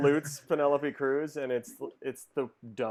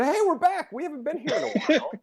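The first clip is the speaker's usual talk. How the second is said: much higher, louder, fast announcer burst; hammed-up show intro; 13 semitones higher, 6 dB louder than usual. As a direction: kick off the show like a hyped host. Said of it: "That was a rocky, uh, bizarre".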